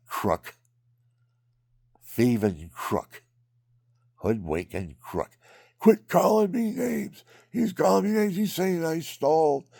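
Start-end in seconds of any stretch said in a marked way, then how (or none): 5.8-9.7 high, loud whiny yell, mocking the thief; mimicked shouting. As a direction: play the cheat crying foul, loud and high. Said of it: "He starts shouting it out".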